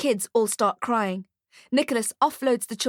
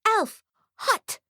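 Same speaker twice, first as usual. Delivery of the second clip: put-on high toddler cry; shouting baby; 13 semitones higher than usual. A narrator's reading. Baby spots the burning elf, high-pitched shout. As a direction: pipe up like an alarmed baby. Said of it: "The baby shouts".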